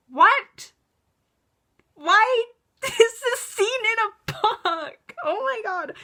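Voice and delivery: loud, high, laughing disbelief at the book; incredulous outburst. Real, not acted. Reacting to the kissing scene in the book, shocked loud disbelief.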